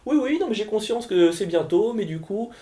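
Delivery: high, insistent sing-song politeness; oblivious photographer. Acted. Photographer asking for two minutes before curtain, breezily insistent. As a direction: keep pressing airily, as a caricatured pest. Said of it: "genre l'air de rien".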